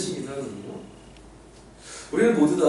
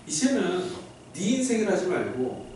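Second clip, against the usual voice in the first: normal talking voice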